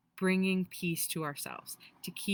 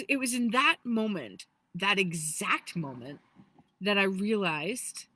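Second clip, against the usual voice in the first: ordinary talk, nothing marked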